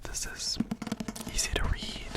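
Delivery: normal talking voice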